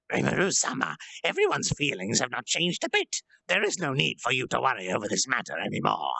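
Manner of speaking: a hint of an earnest goblin retainer; loyal goblin lord. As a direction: reassure your master that nothing has changed. Rigurudo reassures his lord.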